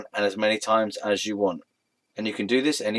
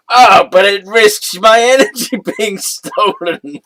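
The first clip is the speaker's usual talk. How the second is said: higher, louder and slow, laughing through it; mock horror breaking into giggles; laughing mock dismay; 8 semitones higher, 10 dB louder, slower than usual. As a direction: fake disappointment cracking into laughter. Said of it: "thinking this is gonna be great".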